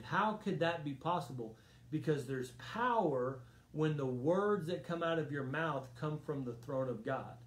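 normal talking voice

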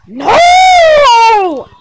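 high, loud refusal; shouted no. Pretend. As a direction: yell it back defiantly. Toy refuses loudly.

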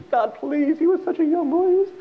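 high, loud wailing plea to God; hammed-up frantic grandmother; hysterical pleading. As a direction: beg desperately at the top of your voice. Grandmother begs God to return boy.

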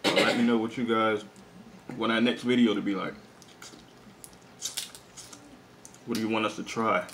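chewing and sucking crab; talking between bites; mouth full of crab. Real, not acted. Seen 0: Left speaker holds crab legs at his lips, biting between words.

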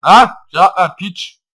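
loud, high furious yell at the screen; angry shout. Real, not acted. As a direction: bark it angrily at the opponent. Yelling at the game opponent. (öfkeli bağırış)

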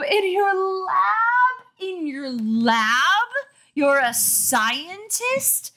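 high, slow, incredulous shriek; high drawn-out outrage; shrieking disbelief. Acted. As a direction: scream at the absurd buffoon. Carly outraged at scientist.

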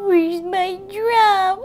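high, slow mock sobbing voice; crying baby doll. Doll cries for its drum, high pretend bawling. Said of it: "It really does look like it's crying".

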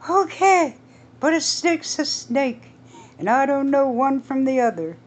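put-on high, loud, panicky older woman; shrill-free high fearful wife voice; alarmed Edith. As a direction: gasp it to her husband in alarm. Edith answers Earl.

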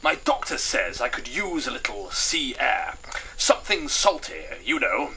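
colonel character. Acted. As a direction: play a chatty colonel. Voices Colonel Toffington.

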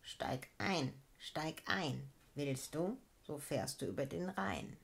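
low, soft, kindly rumbling beetle; friendly growl. A narrator's reading. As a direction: coax her in with a gentle growl. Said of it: "knurrte ganz freundlich".

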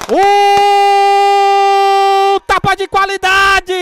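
slow, drawn-out, shouted praise; explosive goal commentary. Real, not acted. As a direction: stretch every word, thrilled and loud.

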